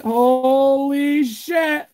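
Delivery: huge, high, loud shouts of joy; real excitement. Real, not acted. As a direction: yell it out like you just hit the jackpot. Reacting to a rare card pull.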